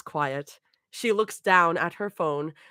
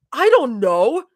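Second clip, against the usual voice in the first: sighing it out, annoyed, higher and louder; irritated sigh; 7 semitones higher, 7 dB louder than usual. A narrator's reading. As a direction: push the words out on an annoyed breath. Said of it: "Mira sighs irritably".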